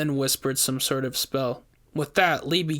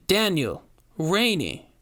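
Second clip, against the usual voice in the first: sing-song, creepy sweet call; sickly sweet voice; 4 semitones higher, slower than usual. A narrator's reading. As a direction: lure them with sweetness. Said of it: "That sickling sweet voice".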